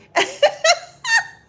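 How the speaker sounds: big shrieking high laugh; loud laughter. Real, not acted. Cracking up at a viewer's joke, loud laugh. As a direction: burst out laughing, real and loud. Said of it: "you got me laughing loud".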